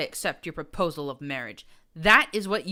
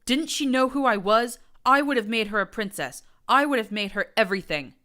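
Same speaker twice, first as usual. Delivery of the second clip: furious rant; 7 semitones higher, 11 dB louder than usual. A narrator's reading. Prince ranting, rejected.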